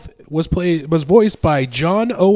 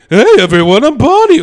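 loud, high cartoon Barney voice; Barney impression; 8 semitones higher, 8 dB louder than usual. Podcast host doing Barney for his co-hosts, loud and high. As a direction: belt out a goofy high-pitched Barney greeting. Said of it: "Here's my impression of Barney".

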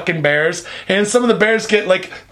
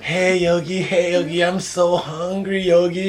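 soft, slow cartoon sidekick; quiet, dragging little bear; Boo Boo; 4 dB quieter, slower than usual. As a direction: plead sleepily like a droopy cartoon bear. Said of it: "He's like".

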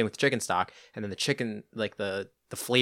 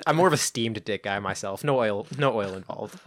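ordinary delivery

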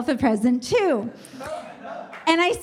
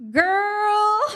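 one long drawn-out word, pitched up; disapproving judgment; 5 semitones higher than usual. Retold or imitated. Customs lady shaking her head at the traveler, drawn-out disapproval. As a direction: imitate a head-shaking reproach, stretching the word out.

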